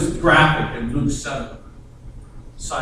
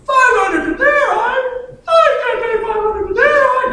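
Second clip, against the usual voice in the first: mock squeaky protest; 5 dB louder than usual. Taxpayer protesting the price to the tax collector, squeaky mock wail. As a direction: whine like a squeaky panicked taxpayer. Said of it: "Not that you would sound like that".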